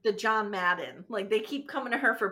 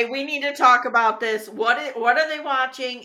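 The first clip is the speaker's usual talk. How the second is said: excited; 9 dB louder than usual. Real, not acted. Excited interruption.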